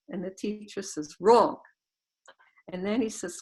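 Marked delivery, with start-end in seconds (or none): 1.2-1.6 a louder, final "Wrong."; firm loud verdict. Retold or imitated. The abbot rejects his student's humble answer, loudly. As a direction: shut the answer down with one word.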